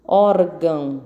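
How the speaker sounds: "Órgão" in two beats, ÓR held; teacher's "Órgão", stress on ÓR; stressed first syllable. Shown as a demonstration. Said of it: "a sílaba tônica é o or".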